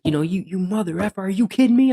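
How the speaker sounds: going off on him. Retold or imitated. Cop yelling at the man who lied about police, furious. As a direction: blow up in disbelief. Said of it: "I went off on the guy".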